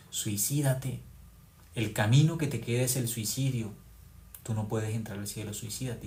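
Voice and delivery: whisper-close temptation to despair; Satan's voice. Retold or imitated. Preacher voicing Satan urging Judas to suicide, soft and insidious. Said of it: "¿qué le dijo?".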